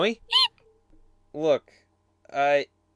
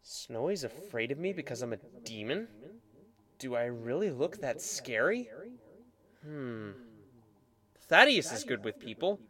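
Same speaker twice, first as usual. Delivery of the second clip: much softer, lower inner musing; muttering to himself; 3 semitones lower, 9 dB quieter than usual. Acted. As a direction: think aloud under the breath.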